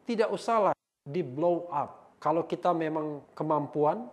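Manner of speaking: loud, declaimed read-out; forceful recital with punched key words; emphatic comment reading. Acted. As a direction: proclaim the comment phrase by phrase. Reads hostile comment aloud.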